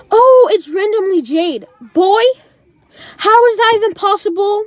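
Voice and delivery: high-pitched, scoffing incredulity; exaggerated indignant disbelief, pitched high; mocking disbelief. Mocking the friend's excuse.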